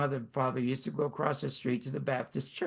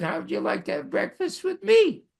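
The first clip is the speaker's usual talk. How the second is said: higher-pitched imitated English accent; 7 semitones higher, 4 dB louder than usual. Handsome driver invites a young man to breakfast, imitated English accent. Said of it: "in this English accent".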